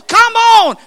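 high, loud beckoning shout; impassioned preacher's summons; urgent calling out. Real, not acted. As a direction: shout them in at the top of your range. Preacher urges listeners to come.

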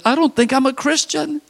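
mock worried teen. Billy faking doubt.

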